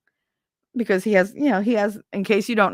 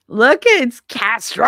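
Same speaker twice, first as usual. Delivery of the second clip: high, loud, slow cry of welcome; light up at a friend; delighted hello; excited greeting; 6 semitones higher, 7 dB louder, slower than usual. Real, not acted.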